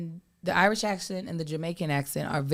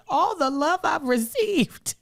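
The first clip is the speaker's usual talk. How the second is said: tearful retelling; 7 semitones higher, slower than usual. Retold or imitated. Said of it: "one man literally started crying for real".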